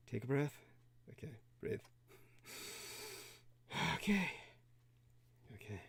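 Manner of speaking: breathy whisper, telling yourself to breathe; self-soothing whisper before going live. Real, not acted. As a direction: whisper a calming reminder to yourself. Calming self before call.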